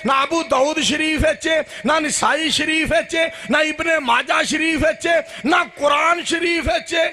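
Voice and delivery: high, insistent repeated refrain; emphatic preaching litany. Real, not acted. Preacher denying it is in any scripture, high insistent litany. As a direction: hammer each book on the same rising tune. Rapid list of denials, preaching.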